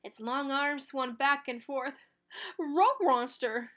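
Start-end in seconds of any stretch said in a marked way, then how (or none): 2.6-3.7 slow, louder cartoon dog yell; Scooby shouting in fright; dog voice crying out; scared dog shout. A narrator's reading. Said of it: "Scooby shouted".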